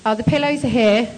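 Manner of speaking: ordinary talk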